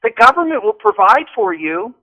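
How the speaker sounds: a hint of high mocking sarcasm; mock the lazy voter, lightly; sarcastic mock. Guest mocking complacent voters, lightly sarcastic.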